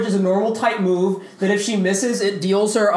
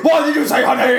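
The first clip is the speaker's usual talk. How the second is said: higher mock-astonished hero; Batman; 5 semitones higher, 4 dB louder than usual. Friend mocking the Martha scene, high mock surprise. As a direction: demand it in a silly raised voice. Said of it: "And Batman's like".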